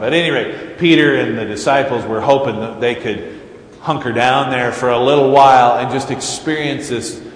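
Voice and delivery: usual speaking voice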